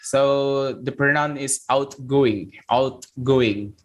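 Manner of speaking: outgoing said slowly with a crisp released T at the end; clear outgoing. Shown as a demonstration. English teacher to class, slow careful outgoing with the T released. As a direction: pop the final T of outgoing. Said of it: "T itu ada letupannya di belakang".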